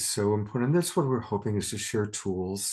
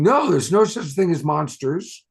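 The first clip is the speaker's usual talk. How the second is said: louder, higher dismissive yell; scolding shout; 6 semitones higher, 9 dB louder than usual. Acted. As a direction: snap it at the frightened kid. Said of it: "You're not gonna scream at her saying".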